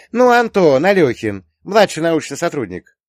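put-on high, loud girlish clarification; insistent daughter. A narrator's reading. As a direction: spell it out as if obvious. Daughter spells out whom she means. (настойчиво, высоким громким голосом дочки)

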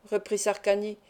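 usual speaking voice

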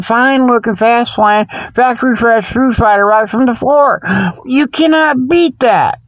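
ordinary delivery

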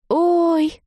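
high, drawn-out oh; startled exclamation. A narrator's reading. Girl peering at the newcomer, startled. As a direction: gasp out a concerned oh.

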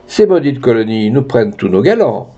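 usual speaking voice

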